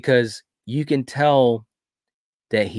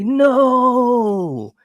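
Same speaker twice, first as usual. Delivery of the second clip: very high, loud cry of "No!"; anguished no; 14 semitones higher, 7 dB louder than usual. Retold or imitated. Father seeing his kids as monsters, loud high anguished no. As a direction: wail it out in horror. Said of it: "He's like".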